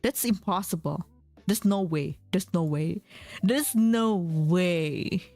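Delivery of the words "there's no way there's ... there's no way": ordinary talk, nothing marked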